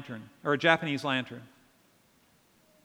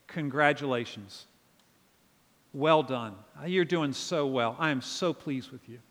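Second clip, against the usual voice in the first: gentle voice of God; 5 semitones lower, 4 dB quieter than usual. Retold or imitated. Voices God praising the believer.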